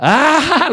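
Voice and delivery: high, loud drawn-out aah. Acted. Son after dad's order, loud high aah. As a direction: let out a big high aah.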